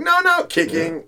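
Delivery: high squeaky no no; squealing girl protest. Retold or imitated. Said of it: "they're like".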